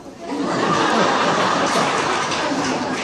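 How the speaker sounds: a big burst of real laughter, high and loud. Real, not acted. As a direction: laugh out loud at the Starbucks memory. Laughing at his own story.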